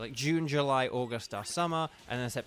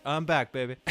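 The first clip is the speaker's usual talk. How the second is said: smooth, cocky cartoon comeback; Bender; 5 dB louder than usual. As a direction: toss it off coolly, imitating a character. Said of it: "it's just so smooth and funny".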